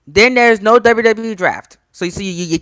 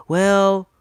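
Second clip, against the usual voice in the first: ordinary talk, nothing marked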